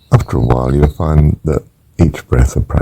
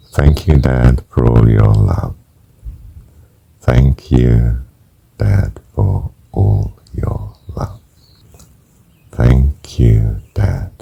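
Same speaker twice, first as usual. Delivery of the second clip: slow breath prayer; 2 semitones lower, much slower than usual. Shown as a demonstration. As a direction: let each thanks stretch along the breath. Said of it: "Let me do this simple one".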